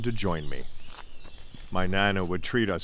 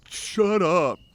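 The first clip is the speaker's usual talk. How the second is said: put-on higher, louder scared-angry kid retort; imitating a rattled friend yelling; mock bellow; 10 semitones higher, 5 dB louder than usual. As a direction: yell at the prankster friend. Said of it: "They'd bellow out things like".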